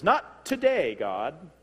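high, loud burst falling off on the last word; hammed-up cheeky refusal; mock defiance. Preacher voicing someone trying to stop God, mock defiance.

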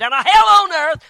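sudden full-voiced pulpit shout; preaching shout. Real, not acted. Preacher climaxes the tale of a saved marriage with a loud shout. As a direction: thunder it out to the congregation.

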